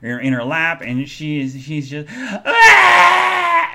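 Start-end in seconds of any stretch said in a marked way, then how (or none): 2.2-3.7 loud, high mock wail; piercing imitation of a child's cry; imitated bawling. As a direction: bawl like a little kid. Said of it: "and he is bawling".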